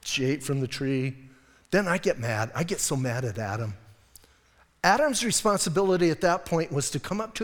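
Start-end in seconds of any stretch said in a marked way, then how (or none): none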